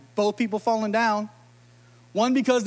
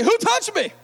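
hammed-up bewildered question; mock Jesus; 10 semitones higher than usual. Jesus asks the swamped crowd, high and bewildered. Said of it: "Jesus like".

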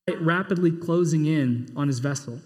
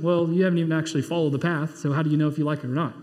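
normal talking voice

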